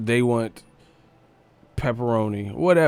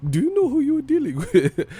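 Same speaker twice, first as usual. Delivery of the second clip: much higher, squeaky theatrical threat; much higher mock villain asking who you're dealing with; extreme put-on voice. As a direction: push the cartoon threat way up high. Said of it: "why are you talking like that".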